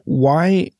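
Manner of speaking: ordinary delivery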